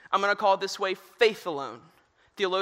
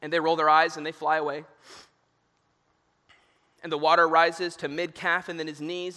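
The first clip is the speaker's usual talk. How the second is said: ordinary delivery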